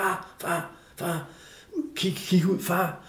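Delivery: soft, hushed mimicked little boy; imitating child. Son waking father at night in the tent, softly imitated child. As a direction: softly nudge a sleeping parent awake. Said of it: "Så sagde han".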